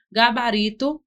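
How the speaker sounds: ordinary talk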